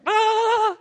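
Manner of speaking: very high, loud held "Ah"; imitated scream of a startled child; long toddler scream. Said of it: "who is like".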